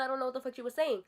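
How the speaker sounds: plain reading voice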